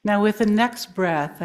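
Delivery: ordinary delivery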